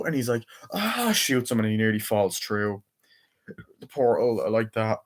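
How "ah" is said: high-pitched mock "ah"; retold shriek at ordinary volume; imitated startled cry. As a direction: pretend yelp, pitched way up. Imitates startled yell before shooting.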